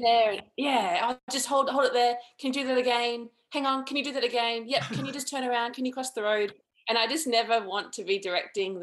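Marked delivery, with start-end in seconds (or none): 0.0-6.5 higher-pitched mock on-set instructions; lightly voiced fussy director. Filmmaker imitates herself directing interviewees, mildly fussy director. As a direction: toss out a string of interrupting requests, pitched up.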